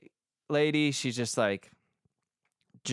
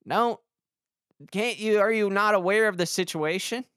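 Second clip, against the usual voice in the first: higher, louder confronting voice; imagining telling off the lottery guy, raised voice; imagined call-out; 8 semitones higher, 4 dB louder than usual. Pretend. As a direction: confront the guy, half-incredulous. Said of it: "do I speak up and be like".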